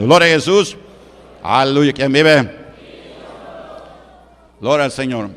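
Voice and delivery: loud call to the congregation; rally the crowd with a shout; praise shout. Real, not acted. Pastor rousing the church, loud call.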